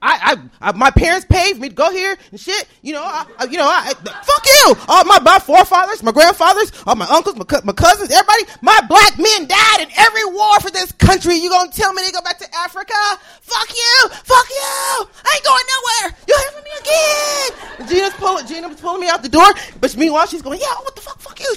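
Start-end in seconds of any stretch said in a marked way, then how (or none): 3.9-4.8 sudden high-pitched curse; angry outburst. Acted. Student out of arguments, high angry outburst. 8.6-17.5 high, strained mock-shouted rant; furious tirade. Student pulled out the door, high furious tirade. Acted tirade while dragged out. 20.6-21.6 high but soft imitated yelling; mock distant yell. Friend yelling while pulling her out, softened high mock yell. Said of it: "She's, like, yelling".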